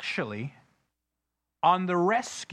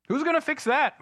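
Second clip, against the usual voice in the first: high, loud mocking question; mock whiny question; 10 semitones higher, 4 dB louder than usual. Preacher mimicking a churchgoer who waits for others, high mock question. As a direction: mimic someone passing the buck. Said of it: "church asking who fixes it".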